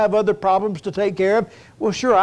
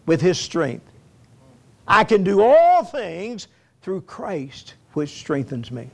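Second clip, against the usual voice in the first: rising, fervent sermon delivery, higher pitched; preaching; 4 semitones higher than usual. Acted. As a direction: preach it out to the congregation, building. Preacher builds to exhortation.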